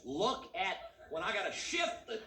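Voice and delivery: cramped country driver loses patience; exasperated yokel outburst. Pretend. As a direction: blow up at the gearstick in character. Driver shouts about gears.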